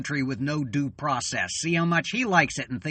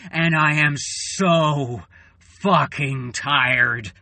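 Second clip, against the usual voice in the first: exhausted anger; 5 dB louder, slower than usual. Real, not acted. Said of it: "I'm so tired and I am so angry".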